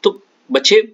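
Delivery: calling out. Real, not acted. Teacher calls class to attention.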